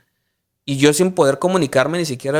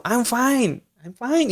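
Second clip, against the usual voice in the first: chirpy clueless reply; 8 semitones higher, 4 dB quieter, much slower than usual. Retold or imitated. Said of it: "Y yo".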